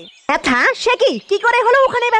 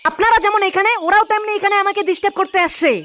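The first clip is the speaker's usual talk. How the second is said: normal talking voice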